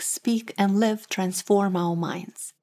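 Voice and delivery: ordinary talk, nothing marked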